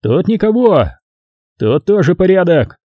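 high call from another room; shouting from inside. A narrator's reading. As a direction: call out the all-clear. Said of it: "Сержант Мэнденхолл крикнул изнутри".

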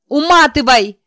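high, loud panicked scream. A narrator's reading. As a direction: scream it out at him. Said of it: "заорала я".

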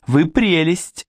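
a high, gushing cry of delight; delighted exclamation. Acted. Vicki gushes gratefully at the inspector, high and delighted. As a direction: burst out with girlish delight. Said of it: "воскликнула Вики".

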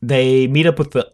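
ordinary talk, nothing marked

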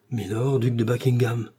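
ordinary delivery

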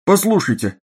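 high and hurried, flustered; fussy. Acted. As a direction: fluster and fuss over her. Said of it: "суетливо сказал охранник".

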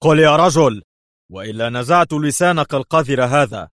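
loud, high, threatening order; angry yell. A narrator's reading. Interrogator threatening a hesitant informant, loud angry yell. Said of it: "صرخ ليفي في وجهه".

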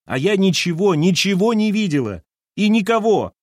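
high, loud cry of despair. A narrator's reading. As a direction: fling the denial out in despair. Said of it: "в голосе её явственно послышалось отчаяние". (громко, с отчаянием)

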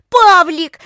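loud, high frightened cry; scream. A narrator's reading. Lika seeing Pavlik in the lake, loud high scream. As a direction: scream the name across the water. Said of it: "закричала Лика".

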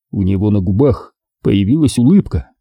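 plain reading voice

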